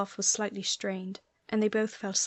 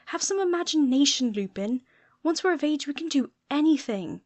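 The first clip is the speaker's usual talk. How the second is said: higher, louder, eager insistence; excited boast; 6 semitones higher, 5 dB louder than usual. Acted. Sirius urging Remus to dream big, eager and raised. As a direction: dream big out loud, fired up. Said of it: "tried to sound indignant".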